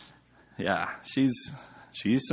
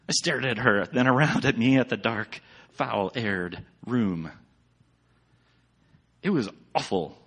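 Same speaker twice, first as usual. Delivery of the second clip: ordinary talk, nothing marked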